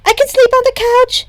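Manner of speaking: put-on high, loud, blurted girl voice; eager Himiko. A narrator's reading. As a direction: jump in with an eager offer. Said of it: "Himiko interrupted".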